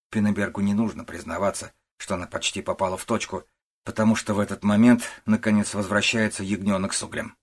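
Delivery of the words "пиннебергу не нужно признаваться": ordinary delivery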